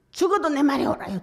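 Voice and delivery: high, loud defiant yell; defiant prophet yelling he is right; stubborn shout. Acted. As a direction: shout back at God obstinately. Stubborn Jonah talking back to God.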